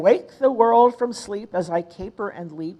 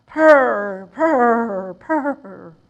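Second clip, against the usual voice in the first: louder purr dying down; kitten purrs; 3 semitones higher, 5 dB louder, much slower than usual. Acted. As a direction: purr contentedly. Kittens purring in poem.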